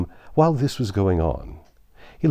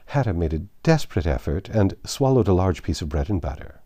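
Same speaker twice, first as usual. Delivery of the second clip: plain reading voice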